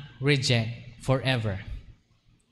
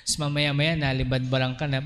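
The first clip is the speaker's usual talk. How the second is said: usual speaking voice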